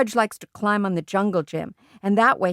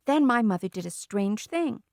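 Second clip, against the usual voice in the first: usual speaking voice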